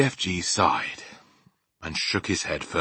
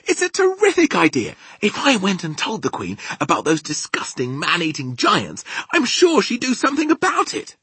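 high, loud, eager gushing; excited; 9 dB louder than usual. Acted. Sophie selling her plan to the giant, excited. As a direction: pitch the idea with mounting thrill. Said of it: "in a voice filled with excitement".

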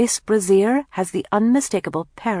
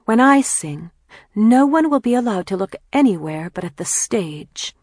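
higher, louder, proud declaration; resolute vow; 5 semitones higher, 5 dB louder than usual. A narrator's reading. Kathleen vows to herself about her singing, resolutely. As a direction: swear it with haughty resolve.

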